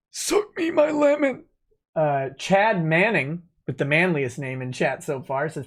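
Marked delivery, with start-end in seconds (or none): 0.1-1.4 high mock voice. Reading a crude chat message.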